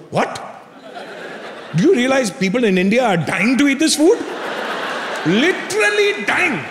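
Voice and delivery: put-on high-pitched Indian-accented mother, incredulous; outraged Indian mom. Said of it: "Mom goes".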